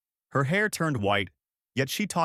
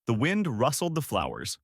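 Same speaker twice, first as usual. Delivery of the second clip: ordinary delivery, nothing marked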